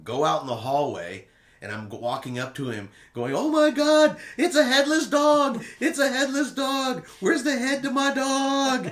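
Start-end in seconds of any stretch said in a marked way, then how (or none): 3.3-8.9 high, loud hammed-up shriek; dramatic mock panic. Prankster to room steward, hammed-up shrieking panic. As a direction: overplay horror at a headless dog. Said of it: "just making it all dramatic".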